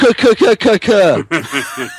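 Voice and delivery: villain laugh. Acted.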